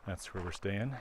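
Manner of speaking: ordinary talk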